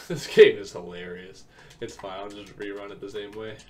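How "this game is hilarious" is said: louder, slow, laughing remark; real amused laugh. Real, not acted. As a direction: laugh into the words. Laughs at losing the play.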